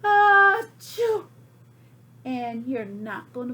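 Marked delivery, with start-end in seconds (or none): 0.0-1.2 a loud, high achoo that falls away; great sneeze. Acted. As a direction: sneeze it out big. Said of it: "I sneezed a great sneeze".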